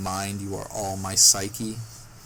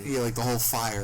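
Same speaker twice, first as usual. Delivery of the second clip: normal talking voice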